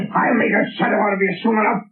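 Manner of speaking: menacing threat. Acted. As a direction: threaten him fast and hard. Captain threatens unseen Shadow.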